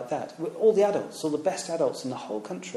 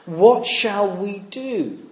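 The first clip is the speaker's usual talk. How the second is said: slow question; 4 semitones higher, 5 dB louder, much slower than usual. Shown as a demonstration. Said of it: "the question for the crowd is the same for us".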